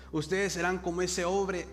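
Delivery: ordinary talk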